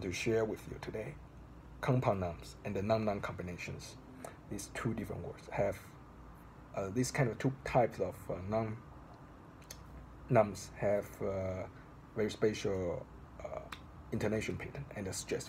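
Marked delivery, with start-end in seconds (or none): none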